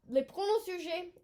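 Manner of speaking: French accent. Shown as a demonstration. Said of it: "French lesson".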